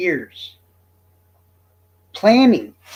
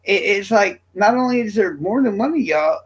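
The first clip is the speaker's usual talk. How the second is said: ordinary talk, nothing marked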